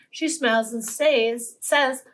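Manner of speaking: ordinary delivery, nothing marked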